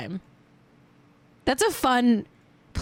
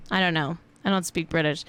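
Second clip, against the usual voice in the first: normal talking voice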